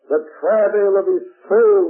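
emphatic proclamation. Real, not acted. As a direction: cry it out from the pulpit. Repeated verse proclaimed.

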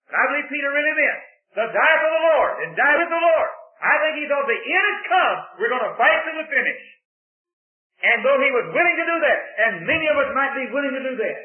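loud, high fervent preaching; shouting sermon climax; preaching at full force. Real, not acted. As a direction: lift the congregation with fire.

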